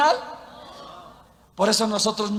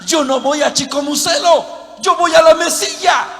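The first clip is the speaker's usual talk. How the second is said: emphatic correction; 5 semitones higher, 5 dB louder than usual. Acted. Driver corrects mistaken passenger.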